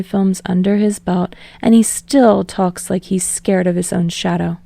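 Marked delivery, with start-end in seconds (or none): none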